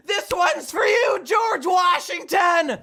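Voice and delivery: high, hollered dedication; hammed-up patriotic victory cry; triumphant shout. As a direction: shout it to the skies, stretching the name. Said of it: "I just shout to myself".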